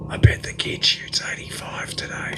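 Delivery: sneering whisper meant to be overheard; snide whispered dig at the kid; sly whisper. Retold or imitated. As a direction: hiss it to the caddy behind your hand. Rival's snide aside to caddy.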